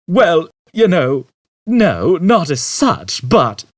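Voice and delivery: Zaphod dithering. A narrator's reading.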